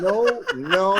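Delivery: high, loud laughing "no, no". Retold or imitated. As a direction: burst out laughing at the mix-up. Realising wrong Shiva punchline.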